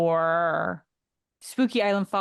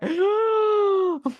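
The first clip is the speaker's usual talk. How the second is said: mock crying gasp; 11 semitones higher, 4 dB louder than usual. Imitating crying fans.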